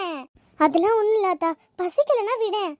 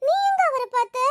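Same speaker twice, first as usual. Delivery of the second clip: loud outburst, then higher questioning; shocked; 3 semitones higher, 4 dB louder than usual. Acted. Mother hearing of the thrown chain, shocked. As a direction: react in alarm and press for answers.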